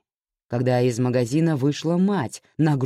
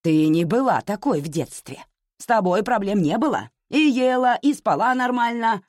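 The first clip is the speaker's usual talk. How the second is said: insistent, climbing higher and louder; mother's stubborn, heated reproach; unyielding; 8 semitones higher, 6 dB louder than usual. A narrator's reading. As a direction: argue back without giving in. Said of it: "не сдавалась мать".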